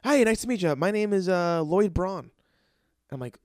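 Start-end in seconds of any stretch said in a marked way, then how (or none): 0.0-2.3 high, loud mock introduction; imitating the buddy's cheerful hello; buddy's intro. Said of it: "And he goes".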